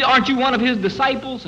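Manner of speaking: a hint of a suspicious servant girl; maid's question. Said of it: "And, uh, she".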